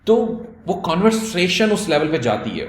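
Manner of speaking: usual speaking voice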